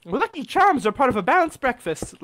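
high-pitched imitation of a leprechaun pitch; mock leprechaun. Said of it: "you said that like".

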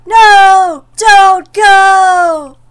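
high, loud cry of no don't go. Acted. Man begging the ghost to stay, loud desperate cry. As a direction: shout the plea with everything. Repeats the plea louder.

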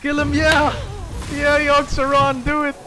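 high, loud gamer hype; excited cheering. Real, not acted. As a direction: cheer the card on at full excitement. Cheering on Yogg Saron.

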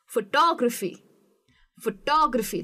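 phoTOgraphy, third-last syllable stressed. Shown as a demonstration. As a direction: lift TO in "photography", then fall away. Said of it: "टॉ जो है ना वो थोड़ा ऊपर".

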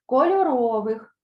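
loud, careful Кольорових; teacher's pronunciation model; clear word drill. Shown as a demonstration. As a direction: say Кольорових slowly, stressed on ВИХ. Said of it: "Повторюйте за мною".

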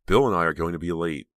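usual speaking voice

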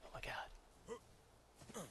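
awed whisper. Real, not acted.

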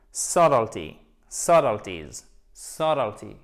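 subtlety, subtleties, each hit on SUT and falling low; first-syllable stress drill. Shown as a demonstration. Teacher repeating the word for learners to copy, first-syllable stress. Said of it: "vous accentuez cette syllabe".

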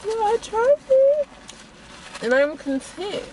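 high sing-song satisfaction; sing-song content. Real, not acted. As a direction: stretch it out happily. Satisfied verdict, drawn out.

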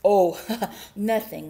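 loud oh, nervous laugh; lying casually. A narrator's reading. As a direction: laugh it off unconvincingly. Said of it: "lied Bear".

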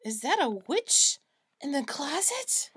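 ordinary delivery

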